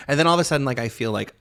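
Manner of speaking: usual speaking voice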